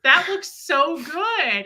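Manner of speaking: slow, high-pitched gushing; excited little boy. Retold or imitated. Imitating the boy excited about the cake, gushing. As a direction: stretch it out in childlike delight. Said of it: "He was pretty excited about the cake".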